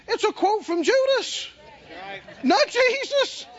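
Judas and Jesus hit high and strong; rising preacher punch; preaching emphasis. Acted. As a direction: drive home not Jesus, slowing.

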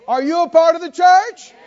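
shouting a rousing question at the church; preaching shout. Real, not acted. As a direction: fire the question at the congregation. Preacher calls out to congregation.

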